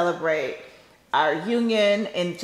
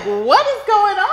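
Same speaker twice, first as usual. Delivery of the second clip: high, drawn-out disbelief; retold baffled outburst, pitched up with a laugh; bewildered; 10 semitones higher, slower than usual. Bride recalling the cheering as she waited with dad, bewildered.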